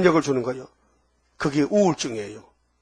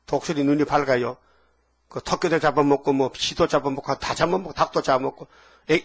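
ordinary delivery

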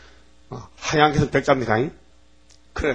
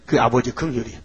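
usual speaking voice

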